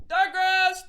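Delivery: high, loud called-out order; mock worker shout. Imitating the worker calling the order, high and loud. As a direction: sing out the order like a busy worker. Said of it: "He's like".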